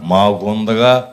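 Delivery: low, slow drawled retort; absent churchgoer. Retold or imitated. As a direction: imitate her slow, low comeback. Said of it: "అంది". (సాగదీస్తూ తగ్గు గొంతుతో ఎదురు జవాబు)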